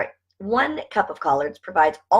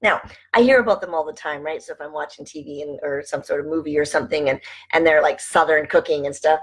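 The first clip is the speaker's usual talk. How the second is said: usual speaking voice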